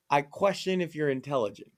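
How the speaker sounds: normal talking voice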